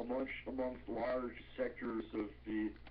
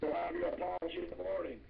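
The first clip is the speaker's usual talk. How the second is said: short, curt and higher; imitating a curt, grudging promise; terse; 6 semitones higher than usual. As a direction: clip the words off, unwilling. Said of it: "he very tersely told me".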